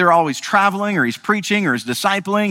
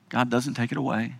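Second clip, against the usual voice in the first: usual speaking voice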